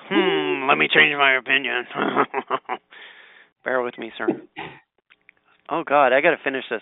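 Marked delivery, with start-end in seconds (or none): 0.1-4.3 lightly voiced ponderous old uncle; a hint of a character voice; put-on uncle-like voice. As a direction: play at being someone's uncle mulling it over. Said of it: "You sound like, um, my uncle when you did that voice".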